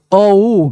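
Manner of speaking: very slow "अ उ", fully joined; drawn-out vowels. Shown as a demonstration. Teacher checking that ओ is heard, drawn out. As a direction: hold "अ" and slide into "उ". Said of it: "बोल के देखो".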